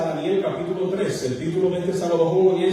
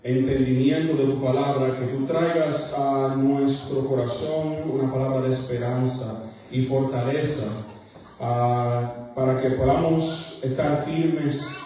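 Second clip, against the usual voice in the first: prayer; 5 semitones lower than usual. Acted. Opening prayer before sermon.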